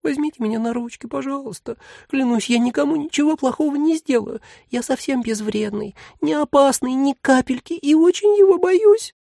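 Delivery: very high, begging little voice; a small demon pleading to be held; plaintive tiny voice. Acted. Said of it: "жалобно попросило".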